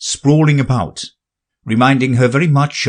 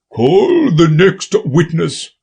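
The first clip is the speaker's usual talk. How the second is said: slow commanding king; 7 semitones higher, 3 dB louder, slower than usual. A narrator's reading. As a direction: call it out royally.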